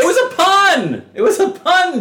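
high, loud frustrated cry; exasperated. Real, not acted. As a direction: plead that it was a joke. Exasperated defense of a joke.

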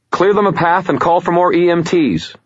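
sharp, loud, high command; barked order. A narrator's reading. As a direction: bark the order. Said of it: "Murphy barked".

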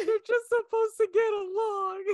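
high, loud tearful protest; mock bawling. Pregnant wife defending her tears to husband, mock bawling. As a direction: imitate yourself blubbering. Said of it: "And I just start bawling".

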